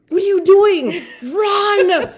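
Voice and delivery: high, loud urgent order; retold frantic shout; screaming. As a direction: imitate a panicked yell to run. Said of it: "screaming at each other".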